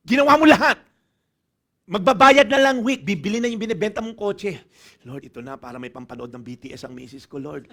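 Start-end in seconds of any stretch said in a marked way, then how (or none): 5.0-7.7 much softer, lower, with a laugh; mock hushed prayer. Believer praying for concert money for his wife, hushed and laughing. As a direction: pray in a hushed, giddy plea.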